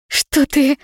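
sharp startled outcry, high and loud; shocked cry. Acted. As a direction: gasp it out in alarm. Shocked cry at torn dress.

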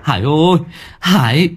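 high, urgent cries while running; breathless yelling. A narrator's reading. Villager running to fetch Hải to the river, breathless yelling. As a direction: raise the alarm in broken, panicked calls. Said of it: "vừa chạy vừa gào thét trong tiếng thở dồn dập".

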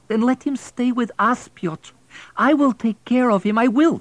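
put-on a wife pleading for the boy.

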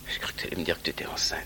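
fast nervous whisper. Real, not acted. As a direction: blurt it under your breath. Anxious confession to wife.

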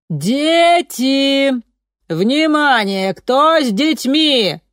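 high, slow megaphone call; megaphone shout. A narrator's reading. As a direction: call out to the crowd through a megaphone. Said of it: "закричала в мегафон".

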